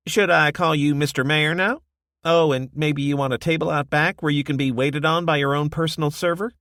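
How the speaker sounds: high, loud mock-deferential ribbing; teasing barkeep. Josie mocking Desmond's new mayor status, high and playful. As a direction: rib him about his new title.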